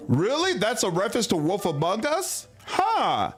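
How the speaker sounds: high, amused surprise; surprised. Real, not acted. Player surprised by chat's reference, amused and high. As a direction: react with a lifted surprise.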